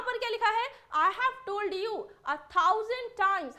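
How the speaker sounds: mock exasperation, stretching each word; exasperated teacher. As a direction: drag it out like a fed-up teacher. Exaggerated exasperated quote.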